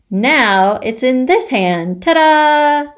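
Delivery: slow reveal then loud high "Ta-da!"; magic trick showman. Acted. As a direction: present it like a stage magician.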